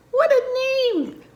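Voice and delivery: very high, loud, drawn-out exclamation; playfully aghast storyteller; amused astonishment. Acted. Exclaims at the horse's name.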